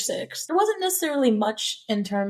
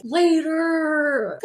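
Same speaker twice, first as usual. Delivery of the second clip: high strained yell; imitating a horny guy's yell; mock scream; 6 semitones higher than usual. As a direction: yell it off at someone.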